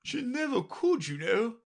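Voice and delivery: put-on a bossy queen lecturing the girl; Red Queen voice. A narrator's reading. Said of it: "said the Red Queen".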